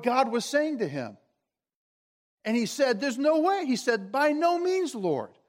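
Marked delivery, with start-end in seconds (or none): 3.0-5.4 higher-pitched, adamant protest; emphatic refusal. Acted. Peter refusing the voice of God, emphatic protest. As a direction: voice Peter digging in against the command.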